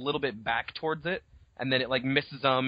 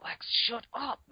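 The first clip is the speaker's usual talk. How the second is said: put-on high, quiet annoyed boy; unyelled hiss; 10 semitones higher, 4 dB quieter than usual. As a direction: snap it without yelling. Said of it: "He doesn't even yell it".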